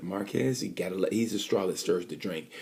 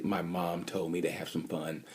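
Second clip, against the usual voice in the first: tender quote; 3 semitones lower, 7 dB quieter than usual. Retold or imitated.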